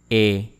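E said as a French closed "ay" without glide. Shown as a demonstration. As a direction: say E as a clear, held French é. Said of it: "Try to imitate my pronunciation".

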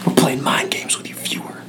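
hushed, voiceless whisper; conspiratorial whisper. Pretend. Teasing the viewer about mind games, whispered. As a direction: whisper it slyly to the audience.

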